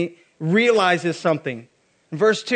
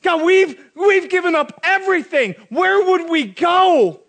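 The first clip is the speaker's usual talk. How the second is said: loud, higher, slowing at the end; impassioned plea; 8 semitones higher, 6 dB louder than usual. Acted. Disciples answering Jesus, loud and desperate. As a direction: plead it out with everything.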